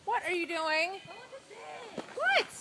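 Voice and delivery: loud, high call to someone. Real, not acted. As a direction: shout her back from afar. Calling someone back from off trail.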